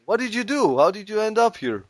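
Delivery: high-pitched inquisitive inmate voice; curious prisoner. Acted. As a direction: press the newcomer in a put-on high voice. Same inmate questions Boris.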